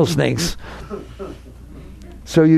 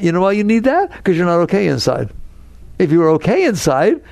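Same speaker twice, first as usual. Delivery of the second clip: ordinary delivery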